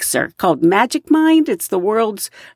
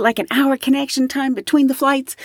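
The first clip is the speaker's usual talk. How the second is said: ordinary delivery, nothing marked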